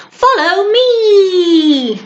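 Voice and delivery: long drawn-out high cry; calling out. A narrator's reading. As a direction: call it out as you plunge away. Santa calling while diving down chimney.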